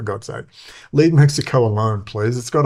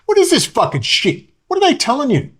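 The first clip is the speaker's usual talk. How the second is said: much higher and louder, fast furious rant; retold angry outburst; 10 semitones higher, 3 dB louder than usual. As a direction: lash out at the guard. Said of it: "And I said".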